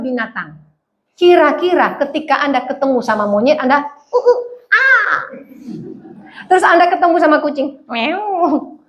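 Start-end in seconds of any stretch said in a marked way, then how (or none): 4.1-5.2 high, slow ape grunts; monkey noises. Pretend. Preacher mimicking a monkey, slow high grunts. 7.8-8.8 meow. Pretend. Cat sound in sermon.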